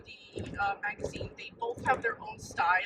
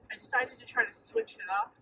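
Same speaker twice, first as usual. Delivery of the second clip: ordinary talk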